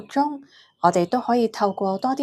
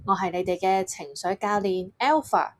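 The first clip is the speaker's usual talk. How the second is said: ordinary talk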